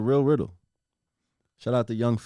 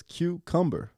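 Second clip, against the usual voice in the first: ordinary talk, nothing marked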